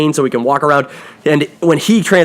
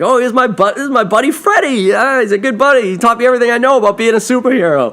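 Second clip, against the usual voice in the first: put-on much higher, fast boastful kid voice; proud Billy; 9 semitones higher than usual. Billy introducing Freddy to the bullies, high and proud. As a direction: show off your buddy to the bullies.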